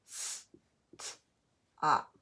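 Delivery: "S-t-op" as hissed s, t, then op, with gaps; tapped-out sounds. Shown as a demonstration. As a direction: sound out "S-t-op" piece by piece. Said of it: "Let's tap".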